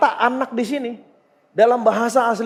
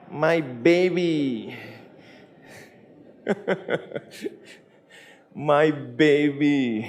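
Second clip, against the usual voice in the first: tender father; 5 semitones lower, slower than usual. Shown as a demonstration. Father gently calls his grown son his baby, tender and slow. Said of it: "Apa artinya anakku? Baby".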